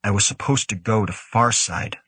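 ordinary delivery, nothing marked

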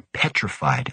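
ordinary delivery, nothing marked

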